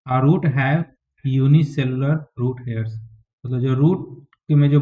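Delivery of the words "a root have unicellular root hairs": slow dictation. Shown as a demonstration. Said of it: "dictating notes to students".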